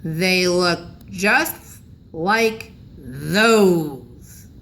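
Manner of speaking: put-on very slow, drawn-out suspicious old man; suspicious growl. A narrator's reading. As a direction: growl it, eyeing the officer's teeth. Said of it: "and growled suspiciously".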